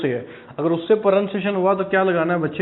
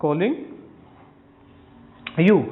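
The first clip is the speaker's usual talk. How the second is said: slow dictation; much slower than usual. Shown as a demonstration. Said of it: "सिर्फ यही बनेगा".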